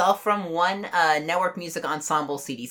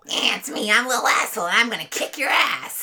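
high, cocky cartoon duck voice; Little Asshole; 4 semitones higher than usual. Co-host imitating the game's small duck, cartoon voice. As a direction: play a tiny tough-guy cartoon character. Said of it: "he talks like this".

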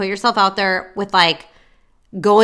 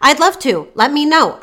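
bright-eyed upbeat reply, pitched higher; exclamation-point cheery; 6 semitones higher, 4 dB louder than usual. Shown as a demonstration. Coach models an easy reply to a match, cheery. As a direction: say it with a smiley face, lifted and eager. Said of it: "exclamation point, smiley face".

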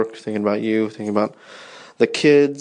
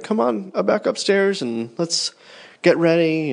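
higher, sing-song call up the stairs; calling to son; 7 semitones higher than usual. Retold or imitated. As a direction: call him back upstairs to get ready. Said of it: "And I called to him".

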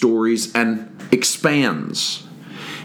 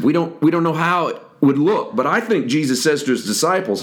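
usual speaking voice

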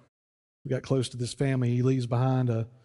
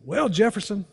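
voicing Bird; 8 semitones higher, 3 dB louder than usual. Pastor voiced in story.

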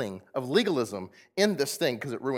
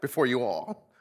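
usual speaking voice

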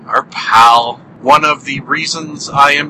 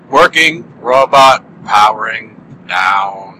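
slow, low, sad robot voice; a robot shutting down, winding slowly; robot powering down; 4 semitones lower, 4 dB louder, slower than usual. Pretend. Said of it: "robots sound so sad when powering down".

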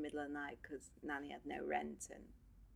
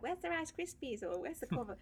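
put-on whiny kid; 7 semitones higher than usual. Kids demanding breakfast from mum.

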